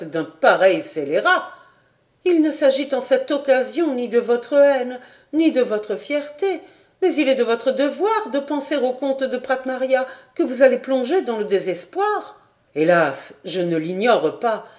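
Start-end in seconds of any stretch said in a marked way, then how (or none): 2.2-12.4 put-on higher young woman's voice, urgent and pleading; Clara pleading. Clara begging du Granec not to leave, higher pleading voice. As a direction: beg him to stay, voice lifted.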